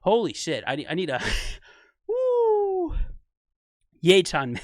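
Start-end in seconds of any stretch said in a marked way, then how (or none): 2.1-3.0 high, delighted whoop; excited woo. Real, not acted. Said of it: "how happy I get".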